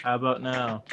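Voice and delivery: mic further away. Shown as a demonstration. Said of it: "That's good".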